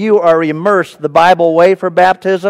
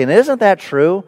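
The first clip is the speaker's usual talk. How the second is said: ordinary talk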